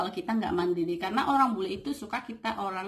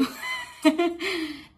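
amused laugh; 10 semitones higher than usual. Real, not acted. Laughing after giving a rude example, amused laugh. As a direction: laugh out at your own example.

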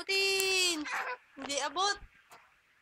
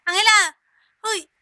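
calling out; 4 semitones lower, 8 dB louder than usual. Real, not acted. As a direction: call her name out loud. Calling out to baby.

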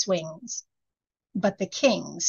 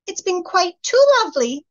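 much higher, louder mock-sweet gushing; gushing fairy lady; 10 semitones higher, 5 dB louder than usual. A narrator's reading. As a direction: fake politeness, high and bright. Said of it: "said the lady with the snake bonnet".

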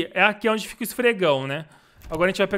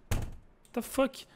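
cursing under breath; 4 semitones higher, 11 dB quieter, much slower than usual. Real, not acted. Muttered at a scare.

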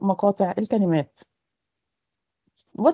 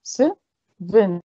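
"seven" split into "se", pause, "ven"; syllable by syllable. Shown as a demonstration. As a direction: break "seven" into two separate beats. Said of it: "How many syllables".

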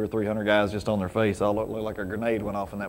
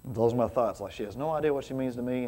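ordinary talk, nothing marked

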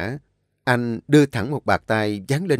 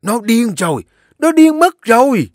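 shrill, much higher and louder cry; outraged grandmother; 12 semitones higher, 9 dB louder than usual. A narrator's reading. Grandmother slams the table, outraged shout. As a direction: explode in outrage. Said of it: "đập mạnh tay vào bàn".

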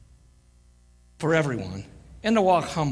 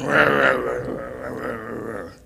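mock grumbling noise; 6 semitones lower, slower than usual. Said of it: "And you're going".

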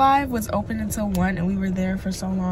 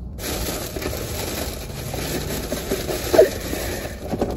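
high breathy giggle. Real, not acted. Laughs mid salad story.

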